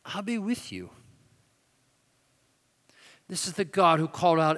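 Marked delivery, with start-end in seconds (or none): 0.0-0.9 soft echo. Acted. Promise echoed softly after pause.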